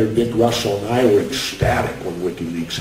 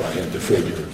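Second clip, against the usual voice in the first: ordinary talk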